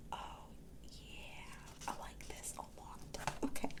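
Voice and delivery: hushed, unvoiced delight; whisper. Real, not acted. Admiring her custom dashboard, soft whisper. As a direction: whisper it to yourself.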